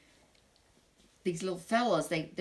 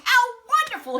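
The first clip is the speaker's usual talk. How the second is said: very high, loud royal gush; delighted cry; 11 dB louder, faster than usual. Acted. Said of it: "he cried".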